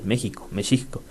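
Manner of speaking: México with a j sound, Mexixco with sh; Spanish then Nahuatl. Shown as a demonstration. Teacher sets Spanish México against Nahuatl Meshico. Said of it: "su traducción normal".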